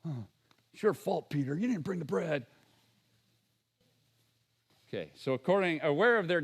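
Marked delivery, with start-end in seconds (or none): 0.0-2.5 mock blaming disciples. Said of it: "But they're like".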